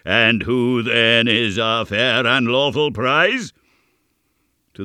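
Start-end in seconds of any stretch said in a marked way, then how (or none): 0.0-3.5 loud, higher, drawn-out proclamation; raised voice. A narrator's reading. Goblin exclaims to his invisible chorus, raised voice. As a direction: call out grandly to a crowd. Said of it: "raising his voice".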